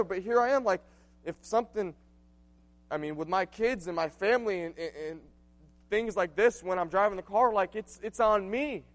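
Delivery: high, loud impassioned preaching; preaching at full force. Acted. Pastor preaching to his church, loud and impassioned. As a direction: drive it home to the congregation.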